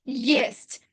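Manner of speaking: ordinary delivery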